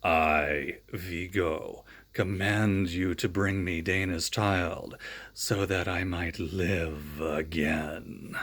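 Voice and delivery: a dark spirit commanding from a painting; Vigo. Acted. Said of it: "Vigo was speaking".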